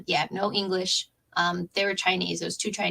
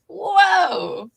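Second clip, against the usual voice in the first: marvelling whoa; 10 semitones higher, 8 dB louder than usual. Real, not acted. Marvelling at passing time.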